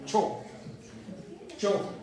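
Cho said short and strong, like English "cho"; a crisp, clear demo syllable Cho. Shown as a demonstration. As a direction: model the Esperanto ĉ as Cho. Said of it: "Cho, ¿verdad?".

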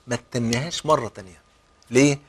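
usual speaking voice

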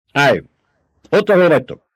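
high, loud order to halt; barked command. A narrator's reading. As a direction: bark the order to stop them. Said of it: "förmött rájuk Hevet". (ráförmedő, hangos parancs)

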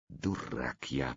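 slow, soft self-reproach; vexed. A narrator's reading. Slavka scolding himself for forgetting rope, slow and soft. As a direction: drag it out low and annoyed at yourself. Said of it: "произнёс он с досадой".

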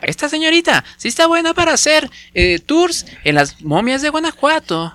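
high, spooky tour-guide voice; odd spooky voice. Pretend. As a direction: talk like a creepy museum guide. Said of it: "Oye, ¿por qué habla así?".

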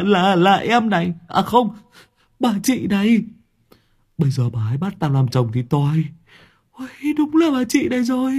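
high, stammering panicky voice, far above the narrator; stammering in terror. Acted. Đoàn Béo stammers to his friend after seeing the ghost photo, panicked high voice. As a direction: stutter out the words in fright. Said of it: "nó mới định thần lại lắp bắp".

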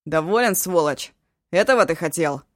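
loud accusing yell; furious shout. Acted. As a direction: hurl the words in rage. Eshu rages at the creature.

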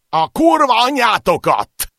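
roared curse. Acted. Wounded man screaming curses at his attackers, furious yell. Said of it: "ordította a sebesült férfi".

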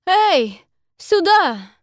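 loud high call; shouting. A narrator's reading. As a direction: shout to be heard across the water. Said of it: "кричит она".

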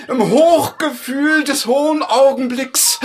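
rapturous exclamation. Acted. Dying poet in rapture.

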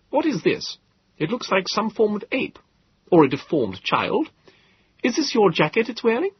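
high, incredulous gentleman's voice; a curious, slightly rude host peering at the creature; astonished host. Acted. Host questions the Morlock.

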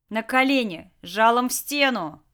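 loud, commanding shout; barked order. Acted. Jack ordering two guards to surrender, loud command. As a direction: order them down by force. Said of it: "приказал двум другим Джек".

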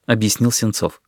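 usual speaking voice

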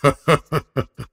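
rough metallic guffaw; grating laugh. A narrator's reading. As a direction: laugh like grinding iron plates. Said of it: "Чёрный рыцарь расхохотался".

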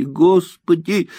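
high, loud, slow wail on "Господи!"; a girl's tearful cry to heaven. Acted. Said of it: "громко заплакала Муся".

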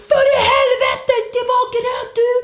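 very high, furious screech; screaming. Retold or imitated. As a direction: mimic an enraged man screaming. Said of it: "han bara skriker".

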